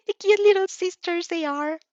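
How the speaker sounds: high, loud mocking coo; squeaky singsong tease; high teasing character voice.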